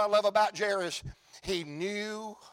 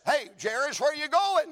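questioning bystander; 6 semitones higher than usual. Acted.

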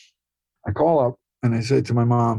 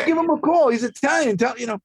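much higher, a lightly voiced Italian mother; imitating his mom; 10 semitones higher than usual. Son voicing his mother pushing him to call Scorsese, lightly higher. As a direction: play your mom urging, pitched up.

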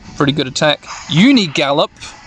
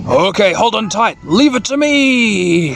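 hammed-up heroic monster voice; Action Ikakumon; 4 semitones higher than usual. Ikakumon takes charge, a drawn-out heroic character voice. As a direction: stretch the last words out heroically. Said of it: "says Action Ikakumon".

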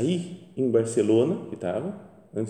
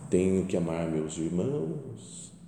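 mock pious; 4 semitones lower, 4 dB quieter, slower than usual. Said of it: "Vai falar".

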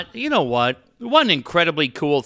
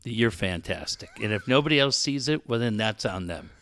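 softer, sugary pep-talk voice; soft inspirational voice; 6 semitones lower, 7 dB quieter than usual. Pretend. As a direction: read it like a sappy motivational post. Parodies an inspirational post.

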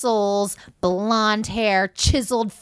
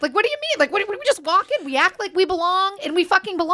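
giddy disbelief; 10 semitones higher, faster than usual. Retold or imitated. Retold thoughts after sneaking in.

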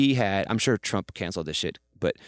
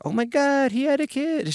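squealed high, much higher than his own voice; gushing high imitation; 4 dB louder, slower than usual. Imitating the first lady gushing over his new baby, squealed high. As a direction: play her as a thrilled Oprah-esque hostess. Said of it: "She's like".

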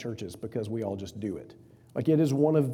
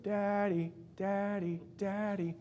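put-on toddler calling; 6 semitones higher, 6 dB quieter, slower than usual.

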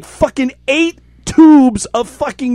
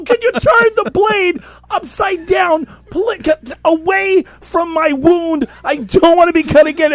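frantic shout; 8 semitones higher than usual. Retold or imitated. Teller shouts at his partner sawing near his thumb. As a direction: shout instructions in a panic. Begging them to flip the blade.

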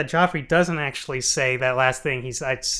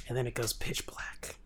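unvoiced, dropped whisper; soft spooky whisper to end the show; 4 semitones lower, 16 dB quieter than usual. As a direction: whisper it like the lights going out. Spooky ending joke.

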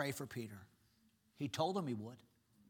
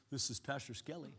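softer, low, smooth phone greeting; pastor voice; 3 semitones lower, 7 dB quieter than usual. Retold or imitated. Pastor picking up an office call, smooth and soft. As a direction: answer the phone in your pastor voice. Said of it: "put on my pastor voice".

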